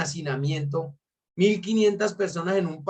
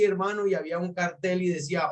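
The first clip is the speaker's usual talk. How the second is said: usual speaking voice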